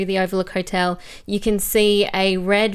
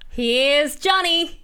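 put-on slow, high Here's Johnny in an Australian accent; drawn-out Australian-accented Here's Johnny, lightly scary; 7 semitones higher, much slower than usual. Fan asks host for her Australian accent, slow and high. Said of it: "'Here's Johnny,' in her amazing Australian accent".

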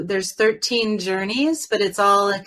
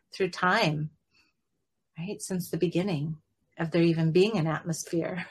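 guided meditation. Acted. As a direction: guide listeners into calm breath. Leads a guided breathing meditation.

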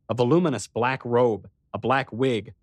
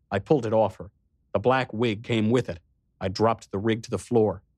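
ordinary delivery, nothing marked

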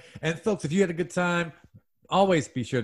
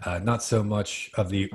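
low, soft, easy English accent; gentle mock-English, softer than Cockney; 6 semitones lower, 6 dB quieter, faster than usual. Shown as a demonstration. Host demos the fifth accent, nice soft English. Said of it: "a little bit softer".

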